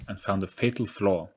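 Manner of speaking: ordinary delivery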